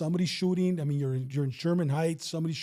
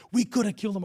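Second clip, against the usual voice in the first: higher-pitched quoted regret; retold cop's complaint; 4 semitones higher, faster than usual. Cop looks back weeks later, retold complaint. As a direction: voice the other cop's complaint, lightly. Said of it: "I remember he goes".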